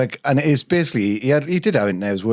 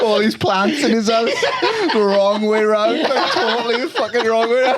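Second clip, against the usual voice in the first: laughing; 9 semitones higher than usual. Retold or imitated. Laughing at the image.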